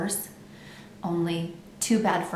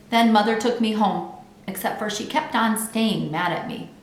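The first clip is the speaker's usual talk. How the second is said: ordinary delivery, nothing marked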